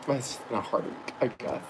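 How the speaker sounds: very soft, spent after crying; acted tearfulness. Vlogger blaming a hard week mid fake breakdown, quietly tearful. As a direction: let it drop to a small, defeated voice. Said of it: "why am I crying?".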